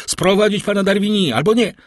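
high, loud, frantic order; acted panicky shout, easing off at the end; urgent cry. A narrator's reading. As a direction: call out high, then rush the rest. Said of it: "zawołał".

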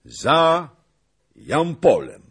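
loud, high, with a dramatic pause; triumphant announcement. A narrator's reading. Zagłoba revealing the hiding place, triumphant. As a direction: proclaim it, each word punched. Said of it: "potoczył triumfalnie swoim zdrowym okiem".

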